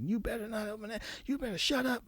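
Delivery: high-pitched, held-back imitation of screamed threats; mock scream. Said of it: "they're screaming at me from the outside".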